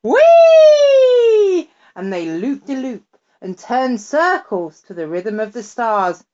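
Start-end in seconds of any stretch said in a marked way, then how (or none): 0.0-1.7 very high, loud sung whee; the flying cat squeals with glee, very high and loud; cat singing whee. A narrator's reading. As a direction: wheee like a cat looping the loop. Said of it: "the cat opened its mouth wide and sang".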